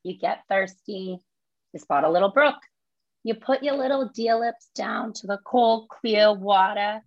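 Lisa's My Cousin Vinny speech in thick Brooklyn; New York accent, pushed up and punchy. Acted. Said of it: "So it's New York accent".

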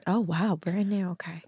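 hushed, breathless whisper. Real, not acted. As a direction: whisper it close to the mic. Whispering about drink.

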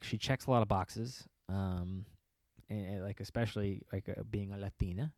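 ordinary delivery

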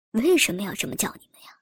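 fast, whispered question; a curious man whispers his question. A narrator's reading. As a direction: ask low and quick, intrigued. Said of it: "问道".

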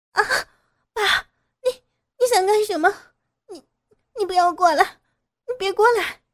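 high, loud frightened shouting; panicked cry. Acted. Daughter-in-law warns father-in-law to stay back, panicked cry. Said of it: "惊恐地喊道".

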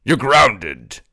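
low, sly courtly voice; a hint of a sly royal schemer; oily scheming vizier.

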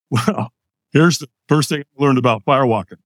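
ordinary talk, nothing marked

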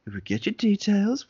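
high-pitched, lightly acted service voice; smooth it over as a clerk, pitched high; placating shop clerk. Clerk back out to the waiting customer, lightly acted high placating voice. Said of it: "come back out there, being like".

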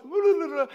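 loud, high tongue-flapping gibberish; imitated babbling. Man who couldn't talk answering his daughter, high mock babble. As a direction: babble as if the tongue won't work. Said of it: "I couldn't talk".

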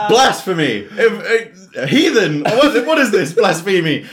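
loud, high, laughing hammed-up outrage; mock outrage. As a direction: cry heresy in mock horror, laughing. Retelling own outraged reaction.

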